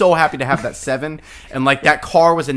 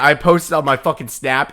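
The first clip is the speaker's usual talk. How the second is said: usual speaking voice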